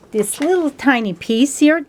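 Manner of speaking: usual speaking voice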